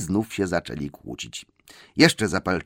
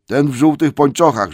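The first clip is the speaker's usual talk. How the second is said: higher, louder blunt troll; 7 semitones higher, 9 dB louder than usual. Acted. Bert loudly names the dwarf in yellow stockings. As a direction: declare it bluntly. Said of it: "rzekł Bert".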